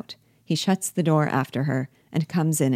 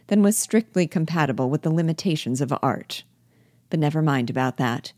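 ordinary delivery

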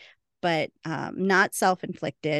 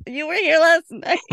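much higher and louder, amused; laughing punchline; 10 semitones higher, 10 dB louder than usual. Retold or imitated. Host voicing Waverly's retort to Wynonna, laughing loudly. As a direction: blurt the comeback through laughter.

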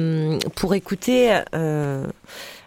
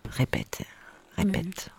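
whispered repeat; 9 dB quieter than usual. Retold or imitated. Asking someone to repeat, whispered.

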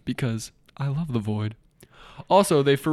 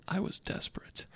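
whispered; 6 semitones higher, 12 dB quieter than usual. Acted. Dramatic reading of a review.

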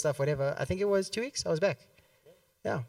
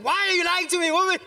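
mock indignation; 11 semitones higher, 4 dB louder, faster than usual. Accusing mom over noodle count.

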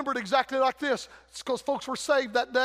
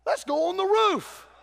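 voiced roof crew; 5 semitones higher, 4 dB louder, slower than usual. Preacher voicing men in Bible story.

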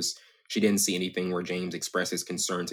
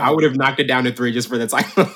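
says it through a loud laugh; 6 semitones higher, 11 dB louder than usual. Real, not acted. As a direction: cracks up while speaking. Joking about the title.

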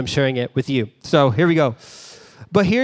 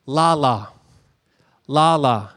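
shepherd's lay-down call; 3 semitones lower, slower than usual. Retold or imitated. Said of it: "he would say to the sheep".

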